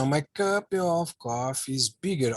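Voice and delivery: slow, clear English example for the class; slow model reading. Shown as a demonstration. Teacher reading the example sentence slowly to the class. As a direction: read it out slowly so students can follow.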